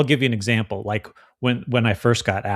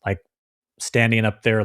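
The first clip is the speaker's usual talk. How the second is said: usual speaking voice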